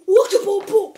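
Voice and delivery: sudden booming nonsense shout; mock battle cry; silly shout. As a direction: blurt it out as loud as you can. Announced silly outburst.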